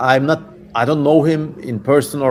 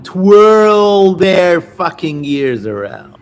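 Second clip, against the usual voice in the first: louder, slow, relishing demon snarl; 5 semitones higher, 5 dB louder, much slower than usual. Acted. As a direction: drag it out with cruel glee. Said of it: "I'm just getting into character now".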